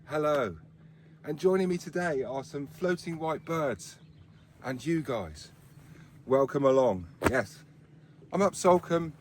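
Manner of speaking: normal talking voice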